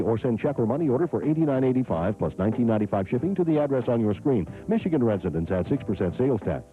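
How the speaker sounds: infomercial. Acted. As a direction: rattle off the fine print. Fast ordering disclaimer.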